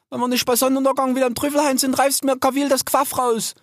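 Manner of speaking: very high, exasperated character voice; young sow calling. A narrator's reading. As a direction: call and scold your runaway little siblings. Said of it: "aus dem Wald eine Stimme erklang". (hohe, genervt rufende Bachenstimme)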